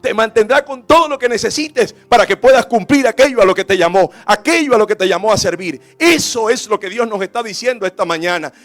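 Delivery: loud, driving sermon delivery; full-force preaching. Acted. Preacher urging the congregation to choose service, forceful preaching. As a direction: press each promise home with force.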